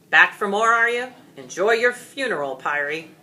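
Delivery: loud, booming dragon taunt; a dragon roaring defiance at his foe; thundered. Acted. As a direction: thunder it at the knight. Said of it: "Graham thundered".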